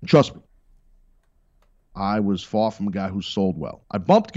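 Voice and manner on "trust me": loud, punchy Trust me; all caps. Real, not acted. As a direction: hit Trust me loud, in capitals. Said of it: "say all caps before you".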